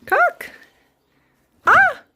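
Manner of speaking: very high, sharp ah; high startled cry. Pretend. Mother urges her daughter up and she falls, very high yelp. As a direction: yelp as she drops again.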